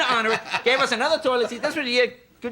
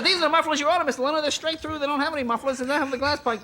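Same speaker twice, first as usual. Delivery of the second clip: lightly voiced dealer, pitched higher; hint of a salesman, raised pitch; car dealer explaining; 7 semitones higher than usual. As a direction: explain it to an upset customer. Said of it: "And the guy says".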